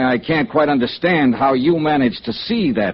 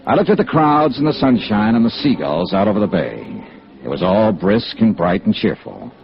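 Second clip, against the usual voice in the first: low, steady first-person narration; a detective recounts the hearing to the audience in narration; story narration; 4 semitones lower than usual. A narrator's reading. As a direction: tell it to the listener, reflective.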